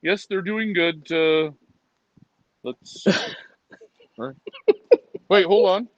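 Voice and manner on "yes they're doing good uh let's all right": low, affected reporting voice; put-on voice. Answering how the birds are doing, low put-on voice. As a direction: drop low and report haltingly. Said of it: "when you talk like that".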